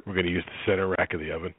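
low, unexcited cooking instructions; brisk, low-pitched, noncommittal chef; Chef Neutral. Acted. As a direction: play a bland TV cook. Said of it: "everything Chef Neutral makes is, like, very bland".

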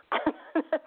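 a bright, high burst of laughter; amused high laugh. Real, not acted. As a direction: laugh up high at her own line. Laughing at her own retort.

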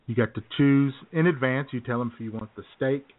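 ordinary talk, nothing marked

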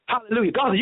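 usual speaking voice

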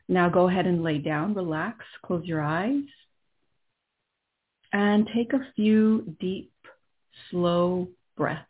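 calm and unhurried, guiding inward; right in your ear. Acted. Leads a guided meditation.

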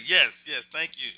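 usual speaking voice

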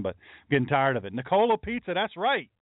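ordinary talk, nothing marked

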